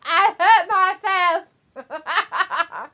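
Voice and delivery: loud, slow mock pain cry with a laugh; hammed-up fake injury. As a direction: overact the hurt, laughing through it. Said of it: "pretend to fake an injury".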